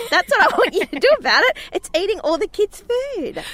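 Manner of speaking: high-pitched imitated outburst; hammed-up exasperated yelling; retold angry rant. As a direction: let the indignation shoot up high, re-enacting it. Retold angry row with owner.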